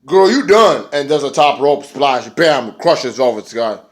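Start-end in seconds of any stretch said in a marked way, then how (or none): 0.1-0.9 higher, louder sassy taunt; mock Kong taunt. Kong taunting Velvet before the splash, high and loud. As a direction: brush her off, big and brash. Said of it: "Kong is like".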